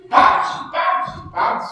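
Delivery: loud high bouncing "Bounce"; spring each "Bounce" up like Tigger; bouncy Tigger. Retold or imitated. Preacher showing Peter as bouncy Tigger, loud and high. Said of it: "He's like Tigger".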